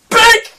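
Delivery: high, loud cartoon impact noise; sudden bink. Storyteller imitating a knock to his head, sudden loud bink. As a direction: punch out one sharp sound for a blow.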